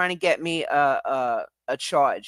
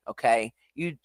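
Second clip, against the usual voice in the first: ordinary talk, nothing marked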